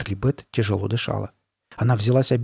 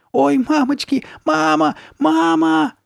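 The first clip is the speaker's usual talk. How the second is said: very high, loud cries for mother; high-pitched panicked squeal; squealing in terror; 5 dB louder, slower than usual. A narrator's reading. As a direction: scream like a terrified little boy. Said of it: "верещал Ноа".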